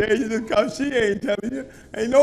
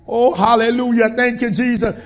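usual speaking voice

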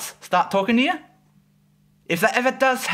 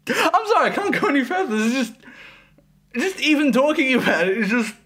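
real fit of giggles, higher voice; laughing; 5 semitones higher than usual. Real, not acted. Reviewer unable to finish the plot summary, laughing. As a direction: try to apologise through helpless laughter. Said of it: "I don't know why I'm laughing at this".

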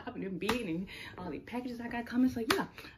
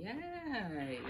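mock sound effect; 4 semitones higher, 5 dB quieter than usual. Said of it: "Put the sound effect in".

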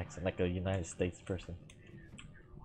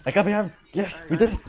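loud, high-pitched cheer; excited victory shout; 11 semitones higher, 11 dB louder, faster than usual. Real, not acted. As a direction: shout it in triumph at the win. Celebrates winning the match.

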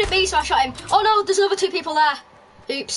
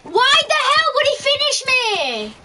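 panicking gamer; 7 semitones higher than usual. Real, not acted. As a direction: yelp in disbelief at being finished. Knocked down mid-fight.

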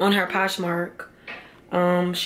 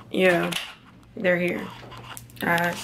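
usual speaking voice

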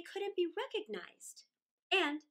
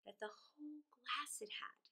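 ominous whisper; 12 dB quieter than usual. A narrator's reading. Ominous note on splinters' power.

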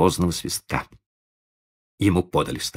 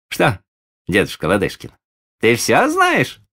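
high, playful taunt; sly teasing; 6 semitones higher, 4 dB louder than usual. Acted. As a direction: tease the old man slyly. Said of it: "поддразнил его лукаво Сергей".

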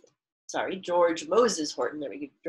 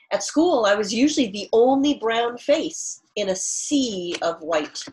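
louder, clear read-aloud for the class; reading aloud to children; 4 semitones higher, 6 dB louder than usual. Acted. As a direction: read the author's words to students. Teacher reads author's note aloud.

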